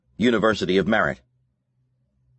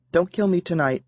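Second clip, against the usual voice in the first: light, higher woman's voice; Serena voice; 11 semitones higher than usual. A narrator's reading.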